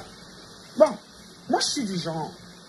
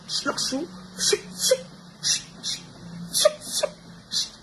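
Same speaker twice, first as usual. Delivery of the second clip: mock hushing; 11 semitones higher, much slower than usual. Mimics hushing a beaten child.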